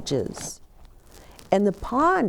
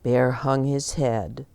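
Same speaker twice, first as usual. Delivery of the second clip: plain reading voice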